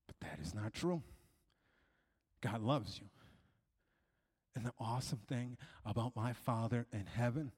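soft, low, earnest altar appeal; hushed assurance, with room between phrases; tender preaching. Real, not acted. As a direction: speak gently to a hurting listener. Hushed, sincere appeal to the congregation.

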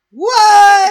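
incredulous shriek. Real, not acted. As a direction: scream it in disbelief. Disbelief at the show's logic.

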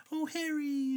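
put-on high-pitched girl character voice; Hermione. Imitating the audiobook narrator doing Hermione, high girlish voice. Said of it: "when it'd be Hermione, he would be like".

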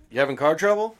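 lightly voiced suspicious stranger; creepy helpful stranger. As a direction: lure them in with fake kindness. Role-play cannibal stranger.

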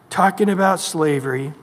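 ordinary delivery

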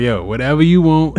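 frantic giving in. Retold or imitated. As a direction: give in at once to a crying child. Acting out giving in to crying child.

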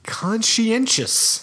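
Conscientious said slowly, syllable by syllable; slow deliberate Conscientious; careful pronunciation. Shown as a demonstration. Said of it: "You're doing it again".

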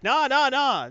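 hammed-up dismissive nah nah nah; dismissive brush-off. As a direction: wave him off with a loud, high nah. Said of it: "He's like".

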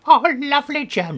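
high, loud gushing ant voice; ant creature voice. Retold or imitated. Imitating the ants gushing over their jam, high and loud. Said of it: "they says".

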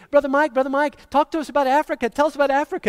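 put-on high, eager little-kid voices begging; pestering kids. Kids crowding the missionary, begging for Africa stories, high and eager. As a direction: imitate children tugging for a story. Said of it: "other little kids kept coming up".

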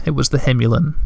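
ordinary delivery

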